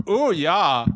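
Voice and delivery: put-on playful German-accented Freud; mock German accent. Imitating bewitched Freud for co-host, mock German accent. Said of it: "That's my German accent".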